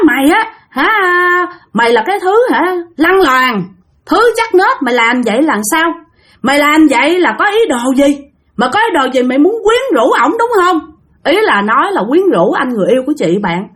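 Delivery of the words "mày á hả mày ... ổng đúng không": high, heated accusing rant; angry accusation. Retold or imitated. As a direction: tear into her like a jealous sister. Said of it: "Bắt đầu hả chửi nha".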